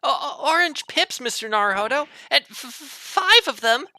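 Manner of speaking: shrieked high, loud and stammering; panicked detective. Acted. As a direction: stutter in horrified disbelief. Voices Sholmes in shocked alarm.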